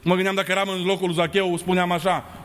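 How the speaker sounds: usual speaking voice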